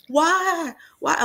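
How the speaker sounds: high-pitched, retold wail of despair; dramatic crying. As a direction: imitate your own dramatic tearful outburst. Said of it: "I was dramatically crying".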